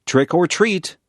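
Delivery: mild mock shout; children shouting. A narrator's reading. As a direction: call it out like excited kids at the door, not full force. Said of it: "the children shout".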